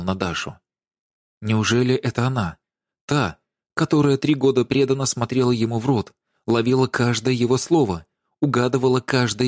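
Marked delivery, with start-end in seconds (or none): none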